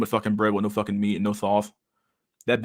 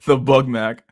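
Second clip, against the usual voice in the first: loud laughing "Bug Mac" with a slow "the, the" lead-in; laughing loudly; 3 semitones higher, 7 dB louder, much slower than usual. Real, not acted. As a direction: crack up while saying "the Bug Mac".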